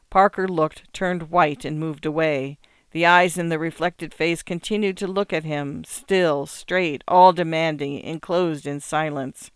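usual speaking voice

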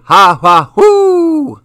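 high yipping ha ha then a soaring hoo; coyote call. Acted. Freya imitating the coyote sound for Johannes, high howl. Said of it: "This is what a coyote says".

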